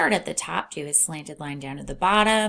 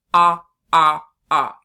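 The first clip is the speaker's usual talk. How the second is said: loud, wide-open ah; loud open vowel; 12 dB louder than usual. Shown as a demonstration. Teacher showing the wide short O sound. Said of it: "open our mouth wide".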